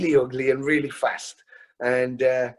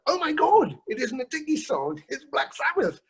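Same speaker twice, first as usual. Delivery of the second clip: retold amazed realization; 12 semitones higher than usual. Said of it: "I was like".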